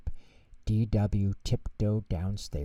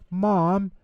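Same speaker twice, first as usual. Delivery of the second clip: higher, louder call from the kitchen; 8 semitones higher, 4 dB louder than usual. A narrator's reading. Arthur calling to Mom from the kitchen, louder. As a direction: shout across the house. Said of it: "he asked".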